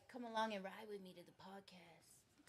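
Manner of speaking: soft whispered mock invitation. Mock invite quote, unvoiced.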